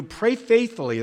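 "pray" lifted high and leaned on, then falling; stress on pray. Shown as a demonstration. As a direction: hit "pray" hard and high as the key word. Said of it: "That's something you should walk away from this".

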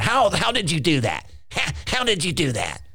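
high, put-on incredulous voice; hammed-up baffled mockery; mocking doubter. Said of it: "being used by the devil to mock".

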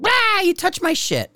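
high-pitched screech of outrage, imitating a student; exaggerated shrieking complaint; mock angry yell. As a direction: squeal it like a hammed-up tantrum. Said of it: "the guys will yell at you".

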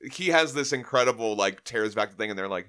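normal talking voice

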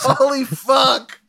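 high, loud, drawn-out exclamation; slow, soaring shout of shock; horrified amazement. Real, not acted. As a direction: cry out in stunned disbelief. Horrified at exploding head.

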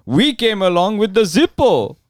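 high-pitched, hammed-up Indian accent; indignant Indian accent, pitched high. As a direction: boast proudly in a put-on Indian accent. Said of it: "I need to hear it in the accent".

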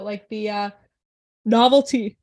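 words stretched by a yawn. Real, not acted. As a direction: talk through a big yawn. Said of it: "I'm yawning".

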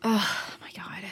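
exasperated whisper under breath; whispered aside. Acted. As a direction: hiss it to yourself. Exasperated aside mid-call.

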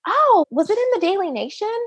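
high-pitched curious ask; eager curious question. Retold or imitated. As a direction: ask as you sense where this is going. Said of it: "And I said".

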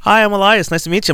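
high, bright-faced greeting in a put-on work voice; corporate voice. Shown as a demonstration. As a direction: greet a coworker in your polite office voice. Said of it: "My voice gets really high".

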